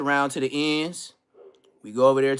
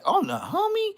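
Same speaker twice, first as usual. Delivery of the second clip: mock shocked question. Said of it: "everybody be like".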